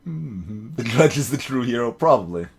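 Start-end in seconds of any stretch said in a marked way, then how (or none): none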